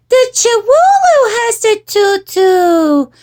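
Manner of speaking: put-on high, loud, drawn-out child voice; singsong it like a little girl; little sister. A narrator's reading. Jujubee answering mama about the tutu, high singsong. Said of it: "replied Jujubee".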